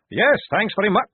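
high, loud, polite acceptance; eager guard. A narrator's reading. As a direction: pipe up gratefully.

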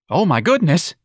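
high, loud fluttering alarm; flustered office manager crying out. Acted. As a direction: cry out in a flustered panic. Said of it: "Mister Gonzalez cried".

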